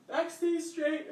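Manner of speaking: said through a real yawn, high and slow; stretched, high-pitched words mid-yawn. Real, not acted. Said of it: "I'm yawning".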